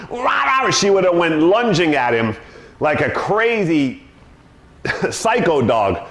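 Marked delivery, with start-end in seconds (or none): 0.1-0.7 mock dog growl. Imitating the dog's growl.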